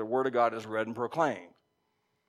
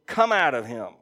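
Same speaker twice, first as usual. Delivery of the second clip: stern command; 6 dB louder than usual. A narrator's reading. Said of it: "Jesus said sternly".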